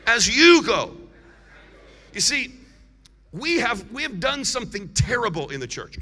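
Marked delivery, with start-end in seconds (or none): 0.1-0.9 "you" called out loud and strong; stress on "you". Shown as a demonstration. Preacher drills congregation on the phrase, loudly stressing "you". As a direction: punch "you" much louder than a normal line. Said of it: "Put the emphasis on you".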